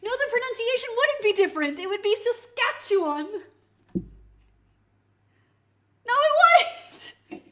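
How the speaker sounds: high, loud protest building to a yell; exasperated shouting. Real, not acted. Streamer arguing pronunciation with a viewer, exasperated shouting. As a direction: argue at full volume, climbing to a shriek. Shouted rebuttal to rage bait.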